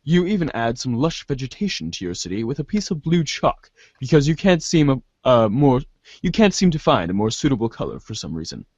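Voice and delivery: normal talking voice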